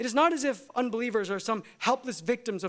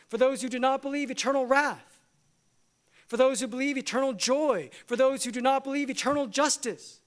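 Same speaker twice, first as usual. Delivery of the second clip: rising preaching; 4 semitones higher than usual. Acted. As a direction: build the refrain like a pulpit call. Rhythmic believe/not believe refrain.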